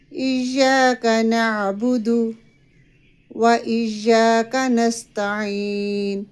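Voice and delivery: slow, drawn-out tajweed recitation; measured Quranic chant. Shown as a demonstration. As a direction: chant each word carefully. Recites verse as model.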